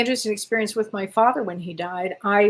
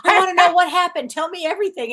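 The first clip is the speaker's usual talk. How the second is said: raised, eager plea; eager demand; 7 semitones higher, 7 dB louder, faster than usual. Retold or imitated. Pressing her late father for answers, raised and eager. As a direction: push him to tell everything. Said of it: "I said".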